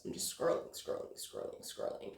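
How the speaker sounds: fading to near whisper, monotone; repeated scrolling sinking into a murmur; trailing murmur. Retold or imitated. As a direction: let the words trail off into a whisper. Mimics mindless scrolling.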